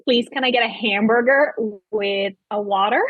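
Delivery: put-on light Californian Valley Girl accent; a hint of a Valley Girl ordering. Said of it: "does sound like a Valley Girl".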